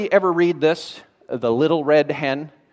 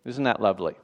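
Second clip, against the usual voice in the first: low, soft, dry aside; deadpan sarcasm; 7 semitones lower, 7 dB quieter, faster than usual. Real, not acted. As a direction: drop it down as mock praise. Sarcastic comment on harsh moral.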